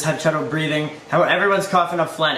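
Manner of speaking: ordinary talk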